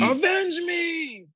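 high, slow, stretched dying cry; overacted death scene. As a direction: wail it out like a hammy death. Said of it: "When he told Harry".

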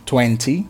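TWENTY with a crisp T, "twen-tee"; non-American twenty. Shown as a demonstration. Teacher repeating for learners, non-American twenty with T. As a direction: sound the T in TWENTY. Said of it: "não americana".

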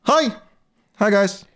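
very high, loud sing-song hello; chirpy guard greeting. Acted. Torpek greets the players at their dorm door, very high and loud. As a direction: greet them in a sudden squeaky chirp. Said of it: "he goes, um".

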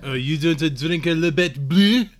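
a hammed-up French accent, slow and loud; mock French accent. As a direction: sneer it like a snobby Frenchman. Mocking a snobby French drinker.

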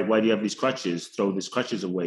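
ordinary delivery

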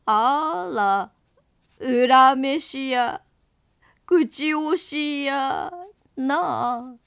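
slow, drawn-out ghostly lament. Acted. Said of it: "こう言いながら".

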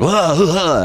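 raspy, high, shouty impression; Al Pacino. Showing how the actor talked after that film, raspy bellowing. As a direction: blurt it out as an over-the-top raspy caricature. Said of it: "like, a raspy voice".

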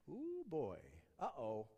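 ordinary talk

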